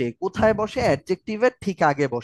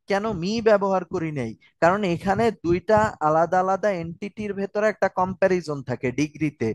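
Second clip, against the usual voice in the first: usual speaking voice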